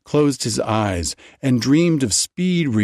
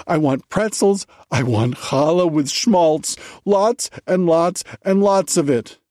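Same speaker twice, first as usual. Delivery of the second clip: fever pitch; 7 semitones higher than usual. A narrator's reading. Starving rabbi lists food to his wife, building to fever pitch. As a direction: let it climb into a frenzy. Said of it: "his voice slowly rising to a fever pitch".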